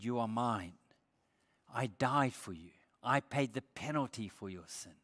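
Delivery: ordinary delivery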